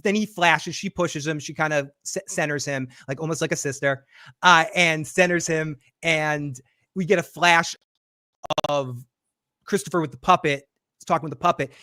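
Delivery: normal talking voice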